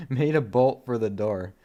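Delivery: laughing so hard the voice cracks; voice cracking with laughter. Real, not acted. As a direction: voice breaks mid-laugh. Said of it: "It made my voice crack".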